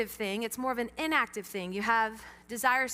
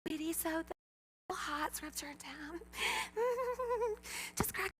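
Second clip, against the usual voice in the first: very soft, higher mock-sweet mom voice; forced self-control; 4 semitones higher, 8 dB quieter than usual. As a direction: act sweet while barely holding it in. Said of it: "I'm in control".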